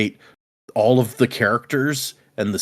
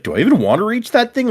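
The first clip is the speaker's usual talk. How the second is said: freaked-out question; 10 semitones higher, faster than usual. Retold or imitated. Freaked out at the creature.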